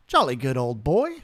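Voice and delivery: put-on joking British accent aimed at viewers; mock British accent. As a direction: ham up a stiff-upper-lip Brit. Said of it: "the accent of the language they speak in the U K, the British accent?".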